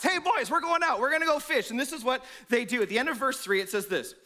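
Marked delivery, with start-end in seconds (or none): 0.0-1.7 high, loud rallying call; calling the lads. Retold or imitated. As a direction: round up the boys.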